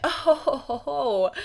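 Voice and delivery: playful belly-laugh tease; mock jolly laugh. Real, not acted. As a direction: laugh it off teasingly. Teasing before the story.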